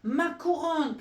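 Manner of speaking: high, loud cry for the crown; goat crying out. Acted. Goat cries after the crown, high and loud. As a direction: shout after your stolen crown. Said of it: "s'écriait le bouc".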